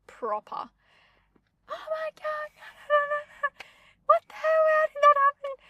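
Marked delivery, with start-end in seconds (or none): 1.7-5.6 very high mock screaming panic; panicked shriek. She reenacts her stuck-in-mud panic, high frantic shriek. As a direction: shriek it like you're stuck among crocs. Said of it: "Just shocked, scared, shocked, screaming".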